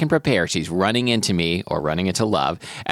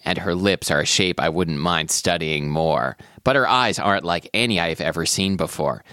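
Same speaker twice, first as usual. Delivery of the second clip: story narration; 3 semitones lower than usual. Acted. Reads romance passage.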